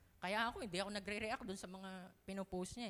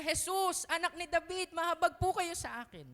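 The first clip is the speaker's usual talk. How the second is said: higher, much louder cry for mercy; loud plea; 7 semitones higher, 9 dB louder than usual. Shown as a demonstration. Preacher showing how to approach Jesus, loud pleading cry. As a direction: beg Jesus at full voice. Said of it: "Imbes na".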